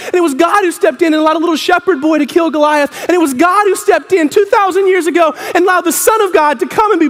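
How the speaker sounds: high, driving sermon cadence; fervent preacher in full flow; impassioned preaching. Real, not acted. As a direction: build a relentless litany to the congregation.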